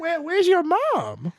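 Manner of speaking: high, slow put-on old lady voice; hammed-up high old-lady voice; imitated old lady. Imitates old lady answering door.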